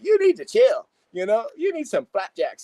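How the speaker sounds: high, sassy motherly soothing. Retold or imitated. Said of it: "she morphed into [name]".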